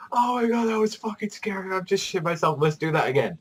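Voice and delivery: high-pitched mock thrill; exhilarated kid. Kid just off a scary thrill, mock exhilaration. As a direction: imitate a scared but thrilled child, starting high. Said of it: "like, exhilarate, like, kids that say".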